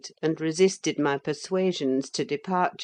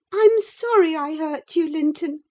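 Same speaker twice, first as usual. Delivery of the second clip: put-on high, louder girlish plea; distressed apology; 12 semitones higher, 6 dB louder than usual. A narrator's reading. Catherine racked beyond endurance apologises, high distressed voice. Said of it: "racked beyond endurance".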